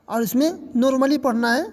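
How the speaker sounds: usual speaking voice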